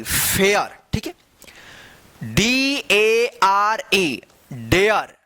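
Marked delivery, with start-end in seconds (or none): 2.3-4.2 each letter drawn out slowly; slow spelling out. Shown as a demonstration. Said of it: "क्या पढ़ेंगे?". (अक्षर-अक्षर धीमे से बोलना)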